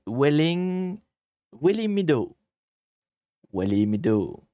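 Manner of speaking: English street name in a French speaker's best accent; attempted English accent. Shown as a demonstration. Streamer reading a street name to viewers, attempted English accent. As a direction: have a careful go at an English accent. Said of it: "mon magnifique accent anglais".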